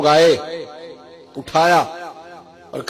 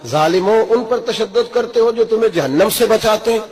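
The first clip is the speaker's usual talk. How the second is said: loud, high-pitched preacher's rebuke; impassioned retold reproach; acted rebuke; 6 semitones higher, 4 dB louder than usual. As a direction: cry out at the oppressors, driving each clause.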